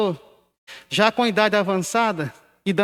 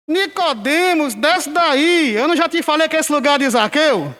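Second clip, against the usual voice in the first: mock scolding; 8 semitones higher than usual. Old pastor refusing to admit his mistake, mock scolding of Nicodemos. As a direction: imitate a pastor telling someone off from the pulpit.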